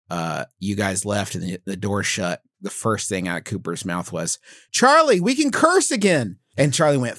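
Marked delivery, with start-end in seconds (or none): 4.7-6.4 high, gleeful imitation of a kid; excited child. Dad imitates his kid telling a sibling the good news, gleeful. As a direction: announce great news like a thrilled child.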